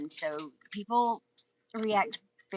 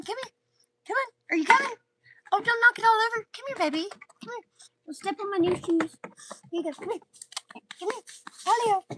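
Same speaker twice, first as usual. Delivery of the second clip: pet talk; 14 semitones higher, 4 dB louder, faster than usual. Real, not acted. Owner calling Apollo over, very high pet talk. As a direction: coax a dog in a high little voice. Calling and coaxing her dog.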